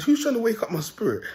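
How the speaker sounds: high indignant question; retold indignant cry. Driver retells his startled complaint at the horn, high-pitched. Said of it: "I said".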